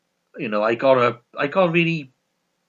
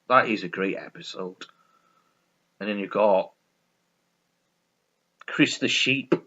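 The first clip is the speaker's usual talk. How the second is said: usual speaking voice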